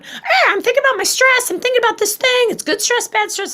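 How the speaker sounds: high, loud, fretful stressed-brain voice; frantic monkey mind. Retold or imitated. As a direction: squeal it like a frazzled animal brain. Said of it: "the dog mammal, going".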